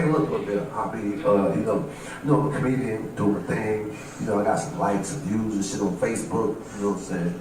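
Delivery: low, soft mumbled excuse; meek excuse. Acted. Comedian excusing himself to Habib at the door, meek. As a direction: make your excuses quietly, pitched well down.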